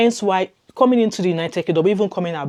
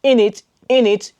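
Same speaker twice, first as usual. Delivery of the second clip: higher, louder mock London innit; a hint of a London accent, mocking; mock British innit; 6 semitones higher, 7 dB louder than usual. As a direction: tease the fake British innit. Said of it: "than trying to fake in it".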